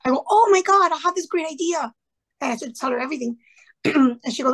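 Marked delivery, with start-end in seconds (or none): 0.3-1.9 thrilled, blurting out a great idea; excited. Retold or imitated. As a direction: jump at the idea. Eager reply to science question.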